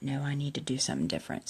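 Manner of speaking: soft unvoiced whisper; recalling her own thought in a whisper.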